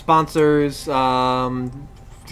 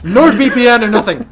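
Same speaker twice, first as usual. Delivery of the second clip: loud mock declaration; 6 semitones higher, 8 dB louder, slower than usual. Joking sponsor rivalry, loud.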